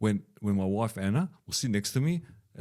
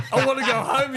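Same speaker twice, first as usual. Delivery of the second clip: high laughing voice; laughing quote of son; 7 dB louder than usual. Retold or imitated. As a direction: laugh through the child's line. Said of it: "he goes".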